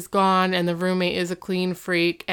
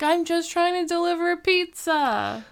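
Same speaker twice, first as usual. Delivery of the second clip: high-pitched mock protest; plaintive protest; 9 semitones higher than usual. Pizza boy being thrown out, protesting. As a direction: plead your innocence in a raised pitch.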